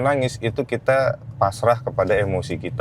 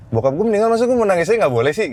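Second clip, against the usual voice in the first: annoyed; 10 semitones higher, faster than usual. Real, not acted. Telling the host about his father's death, annoyed and high-pitched. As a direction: voice rising high in frustration. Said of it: "Yang gue kesel denger kata-kata itu".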